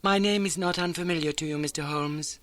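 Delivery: poised and measured, a once-great lady; well modulated, pleasing. Acted. Veiled lodger greeting Holmes, well modulated and pleasing. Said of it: "was well modulated and pleasing".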